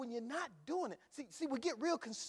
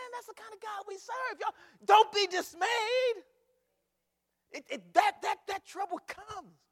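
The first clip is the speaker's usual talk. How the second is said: high preacher's shout; loud, high, stretched-out rallying cry; exultant exhortation; 13 semitones higher, 12 dB louder than usual. Real, not acted. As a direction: holler it to the church, pitched high. Exhorting the congregation.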